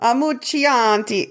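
slow, high, hammy Italian flourish; over-the-top fake Italian accent; mock Italian accent. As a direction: stretch the name out in cartoon Italian. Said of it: "the accent you gave it".

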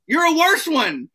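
high, loud triumphant yell; punchline yelled up high. Retold or imitated. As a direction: shout it down the bar in triumph. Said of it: "And I was like".

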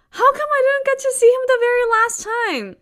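high, loud tearful wail; anguished cry. Retold or imitated. Heartbroken daughter asking why, tearful wail. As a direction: plead through remembered tears. Crying over dead hamster.

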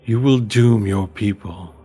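slow, solemn oracle voice; ancient tree voice. Acted. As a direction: intone it like an ancient spirit. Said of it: "you hear a voice enter your head".

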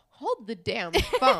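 slow, loud mock outrage; loud disbelief. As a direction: stop everything, each word drawn out and loud. Outraged reaction to the kiss.

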